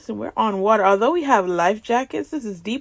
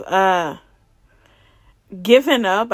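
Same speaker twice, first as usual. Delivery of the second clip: usual speaking voice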